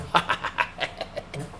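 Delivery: soft airy chuckle; quiet unvoiced laugh; breathy laugh. Real, not acted. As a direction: laugh under your breath at the memory. Laughs at own story.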